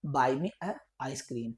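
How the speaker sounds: very slow, word by word; slow teacher demo; very slow drill. Shown as a demonstration. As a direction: let the kids follow each word. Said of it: "क्या बोलेंगे?".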